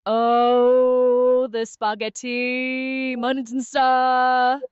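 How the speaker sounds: a wailing, stretched-out spaghetti monster; elongated vowels. Pretend. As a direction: drag every vowel out like a mad moan. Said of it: "Her voice starts to descend into elongated vowels".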